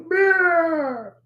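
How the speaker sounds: high cat-like meow; meowing groan. Shown as a demonstration. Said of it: "if I was gonna change my voice and groan".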